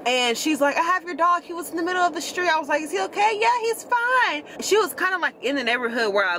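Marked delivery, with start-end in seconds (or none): 0.8-2.5 much higher, polite stranger on the phone; caller's voice. Retold or imitated. Retelling the stranger who called about her dog, high polite voice. 2.8-3.4 worried. Retold or imitated. Worried question retold. 3.4-4.4 much higher, slow and soothing; caller's voice. Retold or imitated. Stranger reassuring the owner that her dog is fine, high slow voice.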